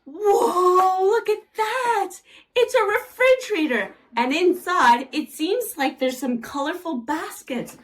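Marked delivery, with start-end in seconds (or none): 0.1-3.9 high, loud gasp of wonder; wide-eyed toy reveal; amazed. Acted.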